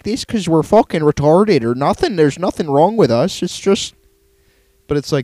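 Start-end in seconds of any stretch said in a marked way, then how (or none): 0.0-2.1 exaggerated Fargo-style Wisconsin accent, higher pitch; lilting, swooping Midwestern caricature. Host imitates Wisconsin locals explaining their talk, broad Midwestern accent. 2.1-3.9 put-on higher, lilting Fargo-style Midwestern accent; mock-defensive Wisconsin caricature with swooping pitch. Host as a Wisconsin local defending the locals, Midwestern accent. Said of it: "like Fargo".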